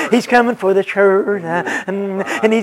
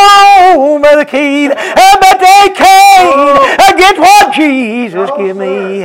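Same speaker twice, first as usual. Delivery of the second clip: high, slow, held-out whoop; whooped preaching; 5 semitones higher, 5 dB louder, slower than usual. Acted. Preacher telling Brother Keith what they can't take, whooped. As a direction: stretch the words into a sung preacher's cry.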